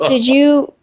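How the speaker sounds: ordinary delivery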